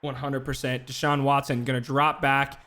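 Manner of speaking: ordinary talk, nothing marked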